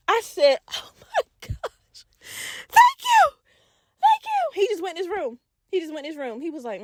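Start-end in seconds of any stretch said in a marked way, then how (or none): 0.7-2.1 slow, breathed-out exclamation; whispered relief. Retold or imitated. Relief at being let off. 2.7-4.6 very high squeal of thanks; overjoyed gratitude. Retold or imitated. Grateful for escaping punishment.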